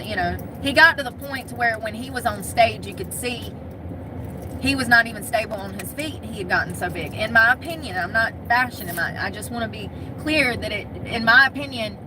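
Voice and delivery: ordinary talk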